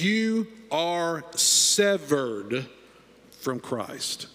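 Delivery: very slow, dropping to a hush on from Christ; grave, slow reading. Shown as a demonstration. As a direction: let each word of You are severed fall heavily. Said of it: "This gets real serious".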